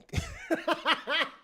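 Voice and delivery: real laugh breaking out; burst of laughter. Real, not acted. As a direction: crack up high at what was read. Reacting to the court texts.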